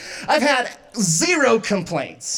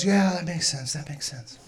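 put-on softly trailing boyfriend reply; imitating meek agreement; 4 semitones lower, 6 dB quieter than usual. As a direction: give in softly, fading away. Boyfriend meekly agreeing, retold.

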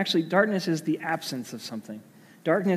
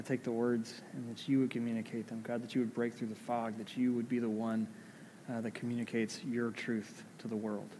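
soft, hushed prayer voice; quiet prayer; 4 semitones lower, 13 dB quieter than usual. Acted. Pastor leading the congregation in prayer, hushed and soft. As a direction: pray gently, drawing the room in.

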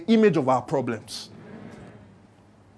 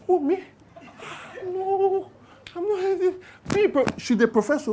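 high, airy brush-off of the title; mock modest denial; 8 semitones higher, 8 dB quieter than usual. Professor feigning modesty at her introduction, high coy voice. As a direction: play it coy and falsely humble. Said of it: "Bimbo looks and says".

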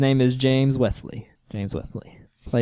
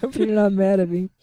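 higher, put-on timid voice; sheepish Karen; 9 semitones higher than usual. Quoting Karen after the big purchase, timid. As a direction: apologise like a guilty coworker.

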